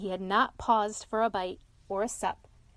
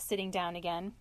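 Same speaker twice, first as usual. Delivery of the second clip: ordinary delivery, nothing marked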